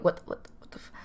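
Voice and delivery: stunned inner thought, whispered; whispered disbelief. Retold or imitated. Teller's silent reaction to the girl's question, whispered disbelief. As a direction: stammer it under the breath, cut off. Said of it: "in my head I'm going".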